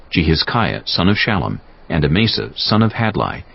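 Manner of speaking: ordinary delivery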